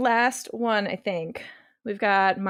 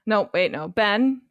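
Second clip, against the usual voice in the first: ordinary talk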